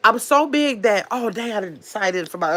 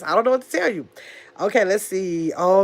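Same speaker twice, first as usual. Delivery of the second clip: ordinary talk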